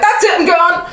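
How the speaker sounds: high-pitched retold alarm, nearly toppling over; playful imitated fright; mock panic. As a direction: squeak it out like you're about to fall. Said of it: "I was like".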